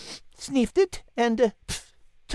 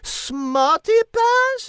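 much higher, louder, very slow outrage; outraged shriek; 9 semitones higher, 3 dB louder, much slower than usual. Acted. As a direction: explode in French indignation. Cat explodes at insult.

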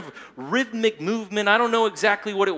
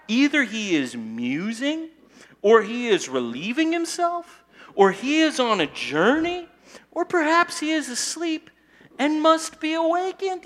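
rising higher and higher in mock concern; godly sarcasm; 8 semitones higher than usual. Elijah mocking Baal's silence, sarcasm climbing higher. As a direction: sneer each excuse for the god, climbing up. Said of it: "This is godly sarcasm".

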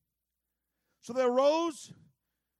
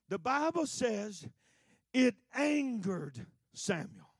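ordinary talk, nothing marked

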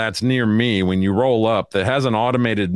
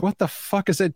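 impatient customer; 10 semitones higher than usual. Retold or imitated. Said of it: "You know, it's like".

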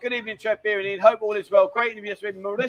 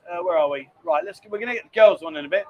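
ordinary talk, nothing marked

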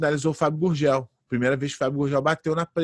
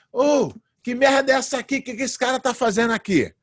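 much higher, outraged mock shout; retold doctor's yell; 9 semitones higher than usual. UFC doctor yelling at the unknown fighter, outraged. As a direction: play the doctor yelling in disbelief. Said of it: "o médico gritou assim".

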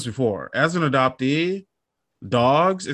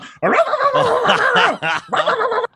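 high, loud rapid-fire barking; full-on dog barking; 4 dB louder than usual. Retold or imitated. Imitating the office dog at a visitor, high frantic barks. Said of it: "it's full on".

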